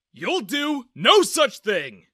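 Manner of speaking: slow, furious shout of a father; angry shout. A narrator's reading. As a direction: shout him down, slow and loud. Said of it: "Dad suddenly interrupted me".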